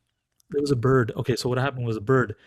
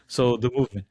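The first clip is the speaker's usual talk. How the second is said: ordinary delivery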